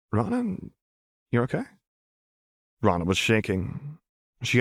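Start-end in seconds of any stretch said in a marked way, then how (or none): 0.1-1.6 tender, worried query; gentle concern. A narrator's reading. Chuck checks on shaken friend.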